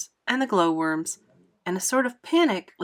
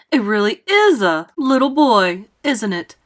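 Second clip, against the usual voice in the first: mildly amazed, louder exclamation; astonished cry; 8 dB louder than usual. A narrator's reading. Fire chief recognizes the little boy, crying out in amazement. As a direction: cry out in some disbelief. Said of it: "cried the head of the fire department".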